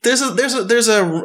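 normal talking voice

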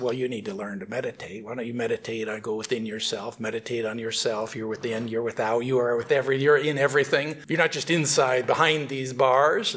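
gentle yogi voice; calm yogi. Acted. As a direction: soothe him like a meditation teacher. Yogi advises meditation.

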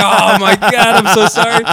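hammed-up horrified embarrassment; mortified apology. Apologizing to new neighbor after mistaken antics, mortified cry.

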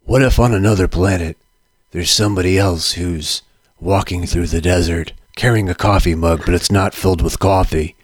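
low gravelly dramatic narrator; deep, brooding movie-narrator rasp; Mickey Rourke gravelly voice. Retold or imitated. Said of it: "Mickey Rourke's gravelly voice".

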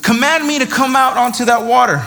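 a hint of an eager, unsure disciple; Peter daring Jesus.

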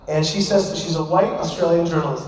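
ordinary talk

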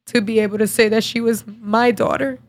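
choked up with real grief; fighting back tears, softening as it goes; halting, voice thick with crying; tearful. Real, not acted. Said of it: "my makeup is gonna look like shit".

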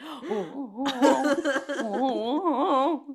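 mock slipping in panic; panicked oh oh. Nonna slipping on icy stairs, panicked oh oh. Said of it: "she was like".

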